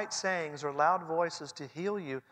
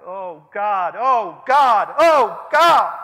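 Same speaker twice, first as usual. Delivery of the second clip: rising desperate cry, louder each time; anguished crying out; 7 semitones higher, 21 dB louder, much slower than usual. Retold or imitated. Preacher voicing a grieving mother crying out to God. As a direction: cry out to God in raw grief. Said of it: "she begins to cry out".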